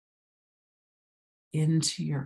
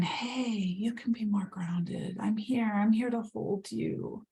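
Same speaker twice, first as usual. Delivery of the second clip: Mother Earth soothing, pitched higher and breathy at first; 5 semitones higher than usual. Pretend. Mother Earth reassuring the listener, higher tender voice. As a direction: speak as the nurturing earth itself. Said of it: "And it's saying".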